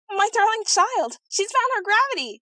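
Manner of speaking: joyful scream. Acted. Old nurse to the fallen princess, screaming with delight. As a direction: shriek the news in pure joy.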